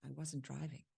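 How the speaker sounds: very soft, almost under the breath; hushed denial. Acted. Character answers his accusation of being there, hushed denial. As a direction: murmur the denial barely audibly. Acted denial, first of two tries.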